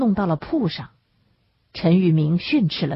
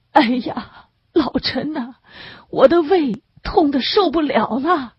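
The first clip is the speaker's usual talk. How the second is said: high, wailing cry of pain; 8 semitones higher than usual. A narrator's reading. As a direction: moan it out to him, pleading. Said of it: "哀哀地哭出声来". (哀哀地哭诉，声音高而颤)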